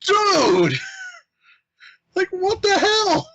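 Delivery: high, loud disbelieving yelp; imitating his own stunned yell at the customer; shocked outburst. Shocked at the customer's crude remark, a high loud cry.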